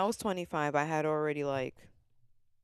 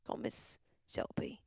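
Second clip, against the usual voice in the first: slow, voiceless whisper; 7 dB quieter, slower than usual. Pretend. Host calling Miss Shelby after saying it aloud, whispered. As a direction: whisper it slowly and secretively.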